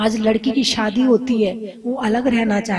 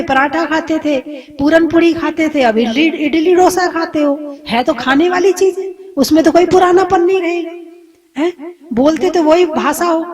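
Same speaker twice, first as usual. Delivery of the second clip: higher and louder, building sermon; fervent preaching; 4 semitones higher, 4 dB louder than usual. Acted. Preacher driving home his point to listeners, building. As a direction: preach it to the crowd, rising.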